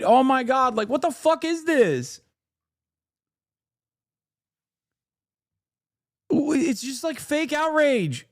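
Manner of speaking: high, exasperated ranting; fed up with the fake outrage; annoyed rant. Real, not acted.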